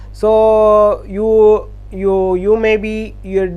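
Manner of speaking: ordinary talk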